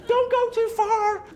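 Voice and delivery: high, slow, fretting call repeated; worried nurturing parent. Acted. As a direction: call it again, pleading gently. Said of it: "Nurturing parents going".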